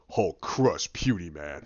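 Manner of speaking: very low monster voice; Hulk. Retold or imitated. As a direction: rumble it low like a giant brute. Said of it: "he walks forward, he goes".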